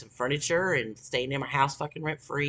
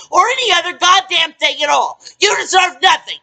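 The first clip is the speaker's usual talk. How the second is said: angry rant climbing into a high, loud shout; real anger building to shouting; 9 semitones higher, 10 dB louder than usual. Real, not acted. Telling someone they have no rights, rage building to a shout. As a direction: build from indignation to a full furious yell. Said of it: "I'm mad as fucking hell".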